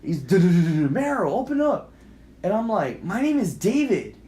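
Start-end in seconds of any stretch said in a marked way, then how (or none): 0.2-0.9 loud rapid knocking noise; loud staccato pounding sound; imitated pounding. 0.9-1.9 high-pitched call through a door; acted stranger's insistent call; demanding call. 3.0-4.1 high, raised reply through a door; acted exasperated shout; calling out.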